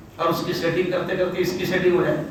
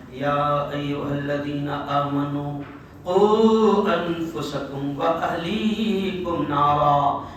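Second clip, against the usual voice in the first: Quranic recitation; 6 semitones lower, much slower than usual. Acted. Preacher recites the verse to his congregation, slow chant. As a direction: intone the verse slowly and lovingly. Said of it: "اللہ کہتا ہے بڑے پیار بھرے انداز میں".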